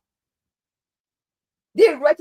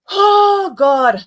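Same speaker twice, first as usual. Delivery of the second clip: much louder and higher, throwing up hands; exasperated outcry; 8 semitones higher, 9 dB louder than usual. Real, not acted. Vlogger exasperated by the government's statement, loud outcry. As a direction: cry out in exasperation.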